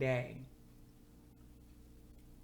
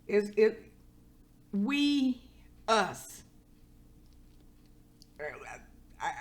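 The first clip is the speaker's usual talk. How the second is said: choked up; 6 semitones higher, 4 dB louder, slower than usual. Real, not acted. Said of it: "My tears just wanna flow".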